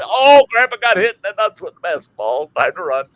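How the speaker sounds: kids' mock panic. Kids fleeing after hitting grandpa.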